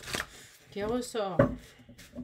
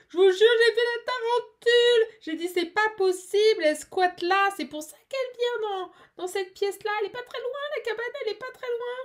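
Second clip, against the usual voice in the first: excited; 9 semitones higher, 6 dB louder, faster than usual. Real, not acted. Excited over seeing the tarantula.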